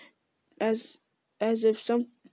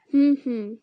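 a louder pondering hum; louder thinking hum; 3 semitones higher, 5 dB louder than usual. A narrator's reading. Ent humming while remembering.

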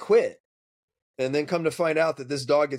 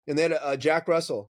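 ordinary talk

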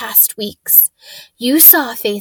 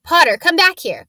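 loud, high command; sharp shout, pitched up; 6 semitones higher, 5 dB louder than usual. Acted. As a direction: yell after the fleeing boy. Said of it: "Quirrell shouted".

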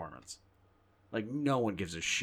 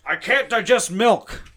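slow, much louder, higher gruff Ford; old Harrison Ford; 10 semitones higher, 12 dB louder, slower than usual. Pretend. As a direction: bellow a complaint like an aging hero. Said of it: "you have to do Harrison Ford loud".

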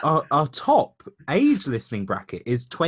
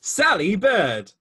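announcer voice; 7 semitones higher, 6 dB louder than usual. Acted. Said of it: "[name]'s fact file, take two".

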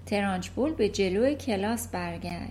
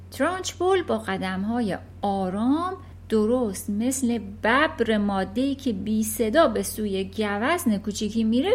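usual speaking voice

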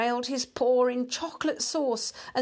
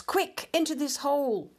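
higher-pitched hurried warning; urgent cry; 6 semitones higher than usual. A narrator's reading. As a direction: urge a friend into hiding. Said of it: "cried the Town Mouse".

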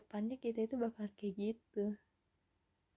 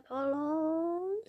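high pleading cry; desperate plea; 8 semitones higher, 3 dB louder than usual. Retold or imitated. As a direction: beg for help, high. Pleading end of acted quote. (memohon dengan suara tinggi)

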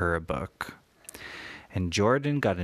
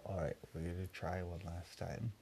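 quiet; 2 semitones lower, 14 dB quieter, faster than usual. Real, not acted. Hushed final attempt.

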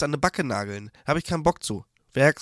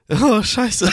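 laughing; 7 semitones higher, 5 dB louder than usual. Real, not acted. Laughing at grumpy cat photo.

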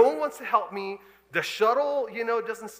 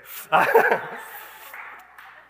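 breathy laugh; 5 dB louder than usual. Real, not acted.

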